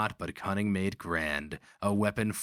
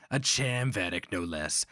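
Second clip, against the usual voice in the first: plain reading voice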